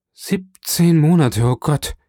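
pained, incredulous exclamation; anguished outcry. A narrator's reading. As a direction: exclaim it slowly, aghast at the figure. Prisoner reacts aghast to inspector.

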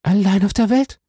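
high-pitched, wounded question; Heidi's startled echo. A narrator's reading. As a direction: echo it back in a high young woman's voice. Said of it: "wiederholte eine Stimme hinter dem Grafen".

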